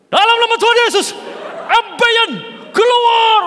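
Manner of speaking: very high, loud commanding prayer; shouted prayer. Retold or imitated. Second prayer warrior shouting the illness for all to hear. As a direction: bellow the deliverance at full force.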